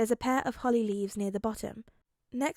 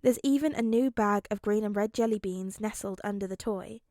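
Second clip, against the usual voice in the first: plain reading voice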